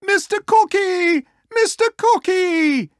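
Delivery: high-pitched, loud frantic calling; squealing, panicked pig yelling a name; urgent shout. Acted. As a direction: shout for help from your neighbour. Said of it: "he shouted".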